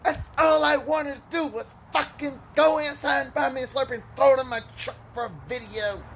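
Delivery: high, loud whining protest; high whiny complaint. Retold or imitated. Truck guy whining to the teller about his Slurpee, high and loud. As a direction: whine it up high like a spoiled kid.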